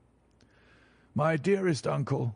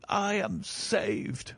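higher still and very slow, almost sobbing with joy; deeper relief; 7 semitones higher, much slower than usual. Acted. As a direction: sink into the relief, stretching it out. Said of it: "The reaction of joy was as passionate".

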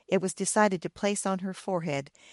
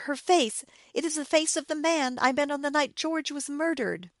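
high-pitched, alarmed exclamation; terrified cry; 7 semitones higher than usual. A narrator's reading. Wife recognizes the face from the murder night, terrified. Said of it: "she cried, terrified".

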